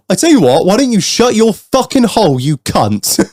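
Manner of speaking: indignant retort, played for the story; acted-out angry outburst. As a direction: re-enact snapping at a stranger. Retold row with rude customer.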